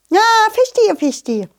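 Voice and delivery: high butterfly voice dropping back; agreeable butterfly. Acted. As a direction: start high and knowing, then settle.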